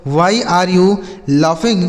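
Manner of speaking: each word said very slowly and clearly; slow clear English. Shown as a demonstration. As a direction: dictate it slowly for learners. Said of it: "जैसे". (बहुत धीरे, साफ़ अंग्रेज़ी उच्चारण)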